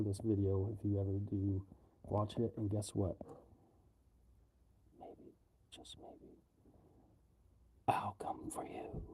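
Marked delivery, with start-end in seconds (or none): 5.0-9.0 hushed mock threat; creepy whisper. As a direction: whisper it like a horror villain.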